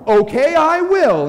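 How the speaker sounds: loud, high, drawn-out sulk; mock tantrum, stretched and loud; petulant child. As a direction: whine it like a child told off. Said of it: "like a petulant child and he's like".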